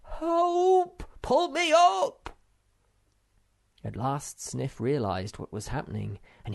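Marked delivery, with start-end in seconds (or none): 0.0-2.4 high, loud, slow cry from below; desperate dangling call; bellow for help. A narrator's reading. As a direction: yell up from the bottom of a cliff. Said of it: "voice bellowed up from below".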